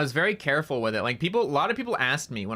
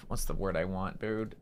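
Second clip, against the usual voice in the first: ordinary talk, nothing marked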